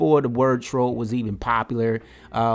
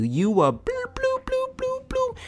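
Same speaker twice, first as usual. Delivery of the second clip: squeaky censor bleeps in place of curse words; mock censor beep sounds; high bleep noises; slower than usual. Bleeping out the caller's cussing.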